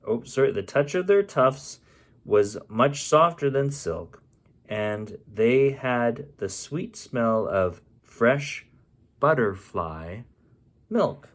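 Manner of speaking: plain reading voice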